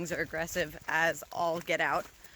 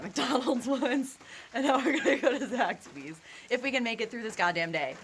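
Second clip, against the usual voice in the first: laughing; 6 semitones higher, 4 dB louder than usual. Real, not acted. Laughing through list.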